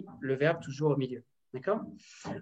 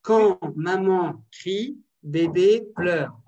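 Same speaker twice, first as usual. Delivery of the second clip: slow; 2 semitones higher, 6 dB louder, much slower than usual. Shown as a demonstration.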